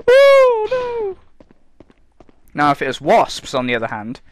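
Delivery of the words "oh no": very high, loud squealed oh no; mocking a scared twat. Mocking someone scared of butterflies, squealed very high. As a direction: imitate a wimp cowering from butterflies. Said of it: "just going".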